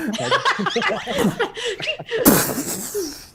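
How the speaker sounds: long helpless high laugh; big laugh. Real, not acted. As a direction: burst out laughing and keep it going. Game master laughs at the antics.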